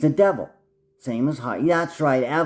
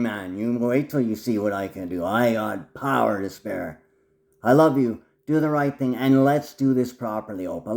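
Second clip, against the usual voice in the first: normal talking voice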